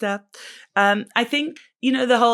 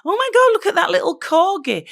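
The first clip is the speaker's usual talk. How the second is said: high, louder cooing over a cute dog; gushing delight; 11 semitones higher, 5 dB louder than usual. Retold or imitated. Mum mocking herself gushing at a fake corgi video, high and delighted. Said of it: "I'm like".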